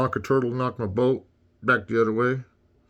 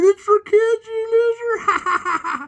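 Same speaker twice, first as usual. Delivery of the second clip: high falsetto jeer, dragged out slowly with a laugh; mocking taunt; 7 dB louder, much slower than usual. Taunting a friend about buying Mario games in a laughing falsetto. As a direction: sneer it in a squeaky, laughing voice. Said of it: "And I said".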